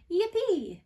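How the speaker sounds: high, loud cheer of yippee; excited yippee. Shown as a demonstration. Preschool teacher showing excitement, loud happy yippee. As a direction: squeal yippee with delight. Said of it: "makes you so excited, you say".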